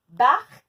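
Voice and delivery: BARQUE said loud, its final k released hard; emphatic sounding-out. Shown as a demonstration. Teacher sounding out Barque for pupils, emphatic. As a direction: hit Barque loudly with a crisp k. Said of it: "on n'entend pas K".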